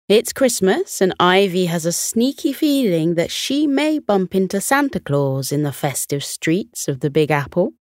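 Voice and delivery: ordinary delivery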